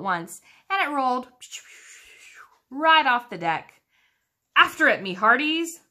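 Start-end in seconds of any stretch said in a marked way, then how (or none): 4.5-5.8 higher, louder order; captain ordering crew after the ball; pirate command. A narrator's reading. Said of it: "Braidbeard commanded".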